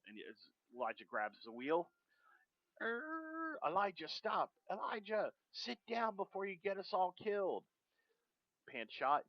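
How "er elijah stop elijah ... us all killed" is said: higher-pitched, hushed and pleading; pleading passenger. Acted. Passenger begging Elijah to stop, high and hushed. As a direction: beg him to stop, voice lifted high.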